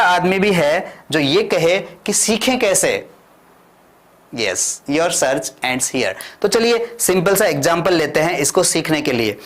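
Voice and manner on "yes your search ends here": slow announcement. Shown as a demonstration. Teacher slowly answering how to learn English. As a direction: let it land slowly. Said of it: "teacher answers own question".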